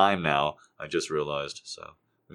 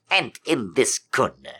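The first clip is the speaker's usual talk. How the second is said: slow ringside intro; boxing announcer; 4 semitones higher, 6 dB louder, slower than usual. Retold or imitated. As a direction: announce the fighter. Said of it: "Howard Cosell".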